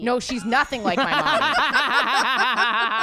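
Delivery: laughing it off; laughing protest. Real, not acted.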